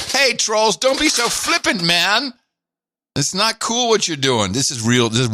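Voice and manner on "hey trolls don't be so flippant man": high, teasing scold of the trolls; mock scold. Host teasing the chat trolls, high mock scold. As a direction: wag a finger at the trolls in a high, mocking voice. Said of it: "I'm gonna say that to everybody now".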